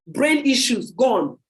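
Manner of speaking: ordinary talk, nothing marked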